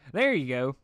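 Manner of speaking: a hint of a folksy farm pony; Applejack's voice. A narrator's reading.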